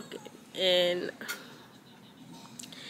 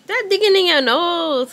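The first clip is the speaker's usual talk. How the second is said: loud scolding; 8 semitones higher, 11 dB louder than usual. Real, not acted. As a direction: scold him loudly for picking his nose. Mother scolding baby.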